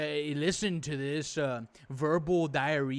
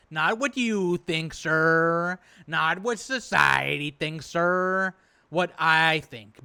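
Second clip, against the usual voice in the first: louder and slow, hammering each phrase; emphatic insistence; 7 dB louder, much slower than usual. Real, not acted. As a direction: lay down the law word by word. Insisting on his own choice.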